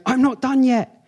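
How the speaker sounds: high, emphatic preacher voicing God; God speaking. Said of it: "God in this scripture is saying".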